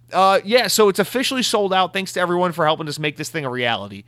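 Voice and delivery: mock corporate thanks, starting high and easing down; mock company announcement. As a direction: imitate a company breezily breaking bad news. Mocking the company's sold-out tweet.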